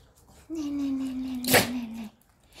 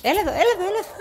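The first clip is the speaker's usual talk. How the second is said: higher, louder call to come; 10 semitones higher, 7 dB louder, much faster than usual. Real, not acted. Owner calls her pet over, high and loud.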